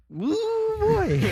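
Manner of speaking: high exclamation of disbelief; a big surprised whoop. Real, not acted.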